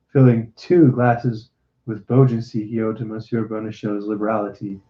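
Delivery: ordinary delivery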